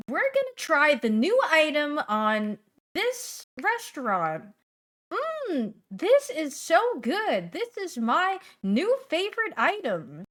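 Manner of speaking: high, bright-eyed reviewer voice that stretches "Mm, this is so good"; food influencer impression. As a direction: hype the new menu item like a food influencer. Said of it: "my food influencer impression".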